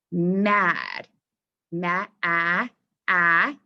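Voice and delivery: "Mad" broken into "ma, ah, ah"; sounded-out short A. Shown as a demonstration. Teacher testing the vowel, "Mad" sounded out in pieces. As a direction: stretch out the cat vowel in "Mad". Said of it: "Am I saying ah".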